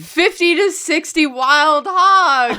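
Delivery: slow, loud cowboy drawl; dramatic cowboy. Acted. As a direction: call it out like a lookout. Dramatic cowboy lookout call.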